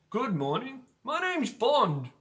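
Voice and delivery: put-on a light Scottish accent as Bond; a hint of Scottish Bond; a mild Scottish accent for the famous line. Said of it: "I did a Scottish one".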